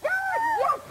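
excited. Real, not acted. Finally popping the balloons.

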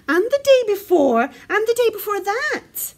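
high, loud little bear piling it on; Baby Bear protesting. A narrator's reading. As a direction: protest insistently in a child's high voice. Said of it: "said Baby Bear".

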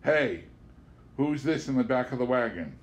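plain reading voice

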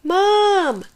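high, loud, stretched yell for mom; little monster calling for mom. A narrator's reading. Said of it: "Albert yelled".

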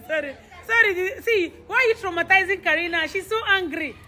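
ordinary talk

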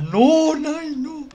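high, loud pleading no; pleading cry. Real, not acted. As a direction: beg her not to cry. Nai starts crying. (súplica aguda y fuerte)

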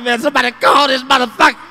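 loud, pleading; desperate kid. Retold or imitated. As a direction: beg like a stressed child. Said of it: "I said".

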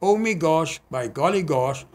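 bouncy refrain with "Oh Me Gosh" lifted each time; playful singsong recital; light-hearted poem. Acted.

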